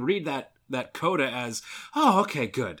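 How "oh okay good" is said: relieved viewer, loud 'oh' settling down; acted relief. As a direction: let the worry drop, playfully acted. Acts out a relieved viewer's reading.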